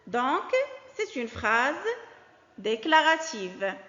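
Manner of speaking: ordinary talk, nothing marked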